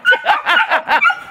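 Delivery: high, rising burst of laughter; real laugh. Real, not acted. Deck soaked by the hose.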